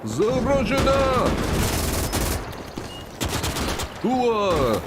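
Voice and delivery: high drawn-out battle cry; rally the troops, slow and high. Pretend. Russian general battle cry.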